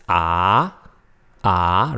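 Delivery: AH sweeping upward; rising tone. Shown as a demonstration. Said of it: "rising tone".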